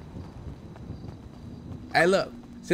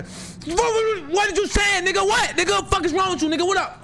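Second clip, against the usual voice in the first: mock street threat; 12 semitones higher than usual. Shown as a demonstration. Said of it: "you gotta psych".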